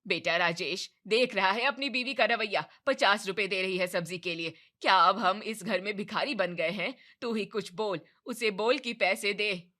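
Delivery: loud outraged mother; indignant complaint. Acted. Mother complaining to son about wife, loud and outraged.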